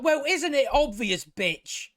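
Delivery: high-pitched, scornful answer; exasperated retort to a stupid question; mocking exasperation. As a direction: spell out the obvious with scorn. Mocks a character's obvious question.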